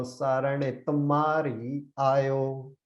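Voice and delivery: slow, careful sarane tumari ayo with the ma in tumari doubled; demo with doubled ma. Shown as a demonstration. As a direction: spell it out slowly, leaning on the ma of tumari. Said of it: "That's how we would say that".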